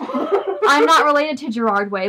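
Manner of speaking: laughing while reading, slowed. Real, not acted. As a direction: giggle through the line. Laughing through a fanfic reading.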